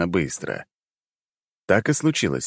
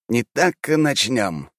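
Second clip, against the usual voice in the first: slow deliberate; 5 semitones higher, much slower than usual. Acted. Count starts the game slowly.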